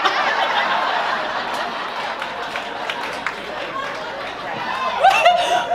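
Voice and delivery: high wheezing laugh; long breathless laugh. Real, not acted.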